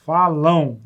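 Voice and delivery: falam drawn out slowly as falão; falão, the ão ending stretched. Shown as a demonstration. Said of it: "Dá a impressão que tá escrito assim".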